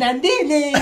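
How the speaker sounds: mocking gal. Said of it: "キャッキャしながらさ".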